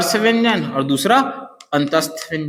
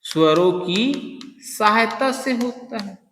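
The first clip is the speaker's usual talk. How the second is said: slow, softer, drawn out for notes; slow dictation; 4 dB quieter, slower than usual. Shown as a demonstration. Teacher dictating the definition, slow and softer. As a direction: say it slowly so students can write. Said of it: "मतलब वे वर्ण जिनका उच्चारण".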